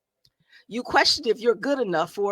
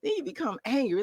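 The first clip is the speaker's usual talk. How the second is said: ordinary talk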